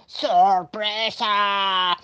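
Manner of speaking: very drawn-out put-on monster voice; slow monster cheer; monster's surprise call.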